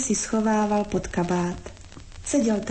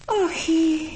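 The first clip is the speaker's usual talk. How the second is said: high, drawn-out "ochy"; wordless little moan, pitched up; moaned sigh; 7 semitones higher than usual. A narrator's reading. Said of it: "bylo mu jen odpovědí".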